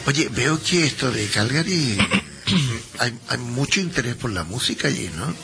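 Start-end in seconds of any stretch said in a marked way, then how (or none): none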